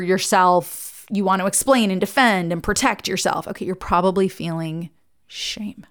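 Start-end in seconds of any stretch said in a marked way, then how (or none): 5.3-5.9 whispered shame. Shown as a demonstration. Naming shame, voice drops away.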